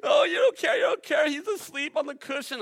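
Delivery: high, loud panicky cry of the disciples; panicked disciples. Acted. Disciples crying out to sleeping Jesus, high panicked plea. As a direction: wail it like frightened men in a storm.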